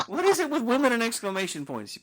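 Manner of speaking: loud, high-pitched mock outrage; mock exasperation. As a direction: throw it out in loud playful disbelief. Repeats acted exasperated complaint louder.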